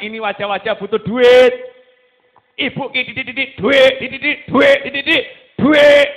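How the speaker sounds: loud, mocking sing-song voice; mocking quote. Preacher mocking a child who only wants money, loud. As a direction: mimic a greedy child sneering. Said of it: "tampak mengatakan".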